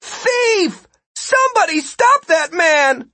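loud, high-pitched alarm cry; shouted. A narrator's reading. Martin chases a thief through crowds, shouting. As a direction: yell to the whole street for help. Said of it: "then shouted".

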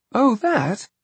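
put-on high casual boy voice; a careless little boy; child voice. A narrator's reading. Voicing Jack.

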